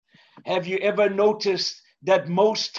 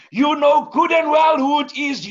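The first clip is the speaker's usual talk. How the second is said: high, loud yell at the window; angry yell; 7 semitones higher, 6 dB louder than usual. Acted. Drenched salesman yelling up at the farmer's window. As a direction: shout up at the farmer, fed up. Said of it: "yelled the salesman".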